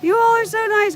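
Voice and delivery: high, loud, delighted shout-out; excited thanks. Real, not acted. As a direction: gush with real excitement at the viewers. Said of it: "I really am excited".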